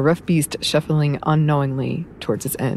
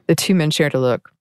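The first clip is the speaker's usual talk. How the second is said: usual speaking voice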